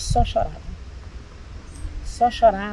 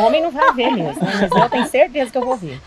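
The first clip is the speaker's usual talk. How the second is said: laughing; 3 semitones higher, 5 dB louder than usual. Real, not acted. Joking about the doctor's promise.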